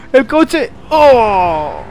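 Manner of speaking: high yelp into a drawn-out oh; excited gamer outcry. Real, not acted. Gamer spotting the car, excited outcry. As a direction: exclaim high, then let the oh fall.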